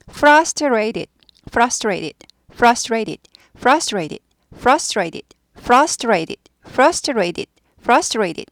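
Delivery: frustrated with the R rolled and the T softened, repeated; rolled r drill. Shown as a demonstration. Teacher leading a repeat-after-me drill, rolled R demo. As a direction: roll the R in frustrated each time. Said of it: "Are이 있을 때는 굴러가요".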